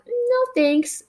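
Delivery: high, mewing cat voice; meowed no thanks. A narrator's reading. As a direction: meow the words like a polite cat. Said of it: "Slipper meowed".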